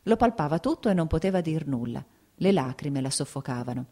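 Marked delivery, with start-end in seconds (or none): none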